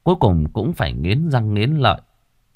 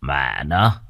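low, disgusted cruel chief; cursing under breath; 7 semitones lower than usual. Acted. Village chief looks at the corpse, low disgusted curse.

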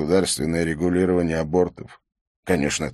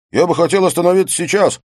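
put-on high, fast nasal drunk whine; 11 semitones higher, 7 dB louder, faster than usual. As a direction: whine it through the nose. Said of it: "прогнусавил он".

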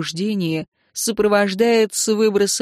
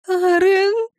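anguished wail, much higher; moaning cry; 12 semitones higher, 3 dB louder than usual. A narrator's reading. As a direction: cry out his name sobbing. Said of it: "простонала Виктория, разрыдавшись".

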